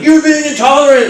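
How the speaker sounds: high-pitched accusing shout; retold yell. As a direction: re-enact yelling back across the room. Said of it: "I was yelling in the D M V".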